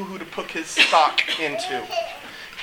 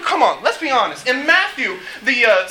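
normal talking voice

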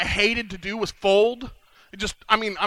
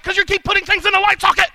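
exasperated retort; 11 semitones higher, 6 dB louder, faster than usual. Acted. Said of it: "It's like".

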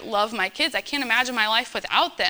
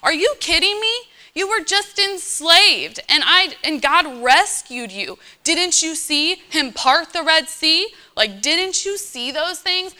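higher, incredulous scolding; a disbelieving leader scolding his people; exasperated Moses; 6 semitones higher than usual. Retold or imitated. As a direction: Moses rebuking the ungrateful people. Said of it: "and Moses comes up, and he's like".

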